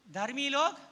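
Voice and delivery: धर्मी लोग called out loud, rising on लोग; 1 emphatic preaching call. Real, not acted. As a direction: proclaim धर्मी लोग to the congregation. Preacher repeats verse opening loudly.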